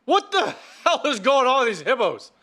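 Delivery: high, laughing disbelief. Real, not acted. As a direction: laugh through the line. Laughing at hippos.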